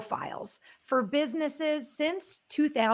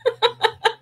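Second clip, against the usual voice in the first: laugh; 12 semitones higher, 6 dB louder than usual. Real, not acted. Host laughs at own accent.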